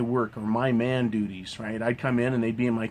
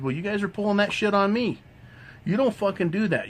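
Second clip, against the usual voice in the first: higher, heated retold telling-off; retold confrontation; 8 semitones higher, faster than usual. Him scolding the women at work, raised and heated. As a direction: scold them, pitch raised. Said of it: "I said".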